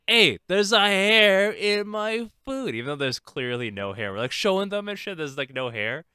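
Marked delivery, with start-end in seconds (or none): 0.1-2.7 slow, high mock complaint; fake complaint. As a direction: pretend to be outraged customers. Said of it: "we just say".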